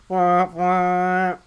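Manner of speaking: long honking "wah, wah", held on one note; imitated truck horn. Co-host playing at the kid who loves trucks, loud horn noise. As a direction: honk like a big rig.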